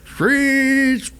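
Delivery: high squealing brake imitation; brakes screech. Retelling the cab accident, high screeching brake sound.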